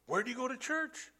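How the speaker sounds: put-on an eager young man asking where he goes to church; curious young man. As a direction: lift the voice high for the eager young questioner. Voicing young man's question.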